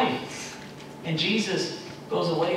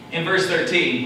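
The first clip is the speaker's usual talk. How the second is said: ordinary delivery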